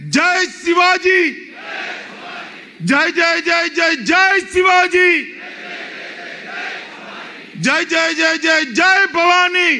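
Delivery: high, slow rally roar; roaring rallying speech. Real, not acted. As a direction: shout the chant with the crowd. Leader roars chant to crowd.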